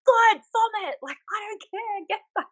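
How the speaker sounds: mock tough instructor. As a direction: brush off a sick recruit, half in jest. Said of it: "And I'm like".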